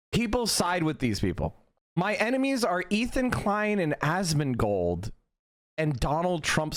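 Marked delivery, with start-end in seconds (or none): none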